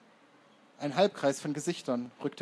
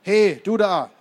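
loud, high call after someone; lauter Zuruf; 6 semitones higher, 13 dB louder, slower than usual. A narrator's reading. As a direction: shout to stop a passer-by. Hitlerjunge ruft dem Erzähler nach. (lauter, herrischer Zuruf von hinten)

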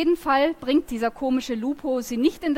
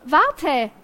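calling after him; 3 semitones higher, 5 dB louder than usual. Acted. Calling after leaving boy.

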